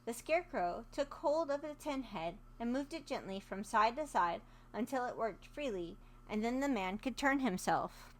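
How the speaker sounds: ordinary delivery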